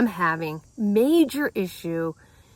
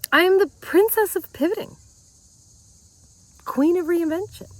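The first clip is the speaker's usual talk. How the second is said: proud boast; 8 semitones higher, 4 dB louder than usual. Real, not acted. Self-crowning boast, high and loud.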